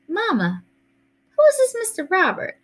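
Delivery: high-pitched anxious question; worried. A narrator's reading. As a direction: lift the pitch sharply in concern. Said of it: "Mama sounded worried".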